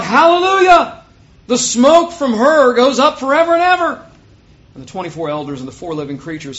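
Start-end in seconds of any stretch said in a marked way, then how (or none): 0.0-4.1 very high, loud shout; full-voiced exultant proclamation; crying out. A narrator's reading. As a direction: shout it in triumphant praise. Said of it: "once more they cried out".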